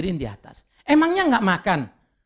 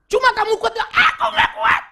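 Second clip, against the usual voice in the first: mock shouting woman; 6 dB louder than usual. Acted counselling quarrel, woman shouting.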